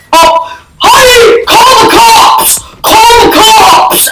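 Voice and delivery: high, slow, wailing shout to his wife; mock panicked cry for help. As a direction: ham up a neighbour crying for the police. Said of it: "calls his wife".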